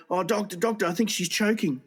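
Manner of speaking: high-pitched, acted fluster. Flustered husband reporting to his wife's doctor, acting panicked. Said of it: "The man's all flustered".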